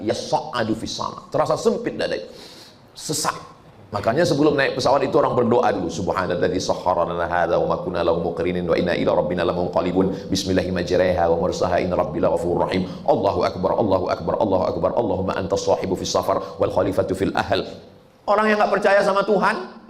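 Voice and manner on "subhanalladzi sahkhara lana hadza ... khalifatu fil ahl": low, steady Arabic prayer recited devoutly; prayer recitation. Acted. Preacher recites the boarding prayer to his congregation, low devout chant. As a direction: pray it low and even, like a travel blessing.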